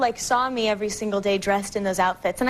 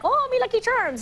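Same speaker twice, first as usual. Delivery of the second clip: high-pitched mock leprechaun voice; 11 semitones higher than usual. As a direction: put on a high little leprechaun voice. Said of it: "kind of a strange leprechaun".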